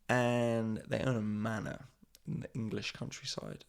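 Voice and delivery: ordinary delivery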